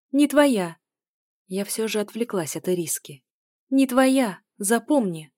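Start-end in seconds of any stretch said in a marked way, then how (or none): 0.1-0.8 higher, louder angry denial; heated, emphatic contradiction; sharp retort. A narrator's reading. Angry argument over whose daughter. 3.7-5.3 higher, louder warning; heated, emphatic correction; sharp retort. A narrator's reading. Angry argument over whose daughter.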